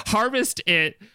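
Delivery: ordinary talk, nothing marked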